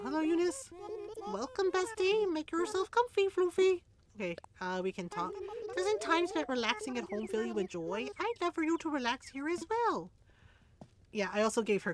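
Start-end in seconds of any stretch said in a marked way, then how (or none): 0.0-3.8 very high, sweet sheep voice; high cute villager voice. Acted. Reads Eunice's greeting. 5.8-10.1 high, sweet storybook voice; high cute villager voice. Acted. Reads Eunice's dialogue.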